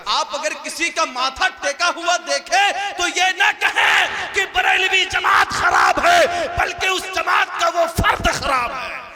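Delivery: shouted, high preacher's voice; full-force sermon shout; fiery preaching. Real, not acted. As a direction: thunder it at the congregation.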